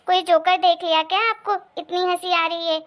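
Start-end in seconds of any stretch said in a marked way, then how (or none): none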